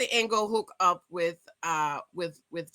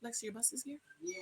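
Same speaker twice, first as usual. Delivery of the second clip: off-mic aside; 20 dB quieter, faster than usual. Real, not acted. Parent asking child about the bus, hushed. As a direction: drop the show voice and ask quietly.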